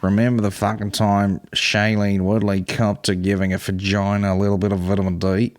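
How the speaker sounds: put-on broad Australian accent. Reading an article aloud, mock Australian accent. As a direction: read it out like an Australian. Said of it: "oh, this is definitely Australian".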